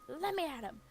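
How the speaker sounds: hammed-up fury; mock outraged dog. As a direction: strain to get at the deer. Dog lunging at deer.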